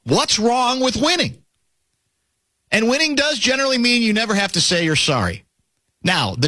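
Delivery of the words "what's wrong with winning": high, slow mock challenge; retold bellow. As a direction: quote it drawn out and raised in pitch. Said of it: "He bellowed this to me".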